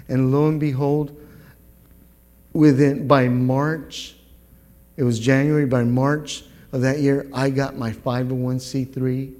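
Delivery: normal talking voice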